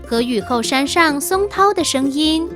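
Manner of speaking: usual speaking voice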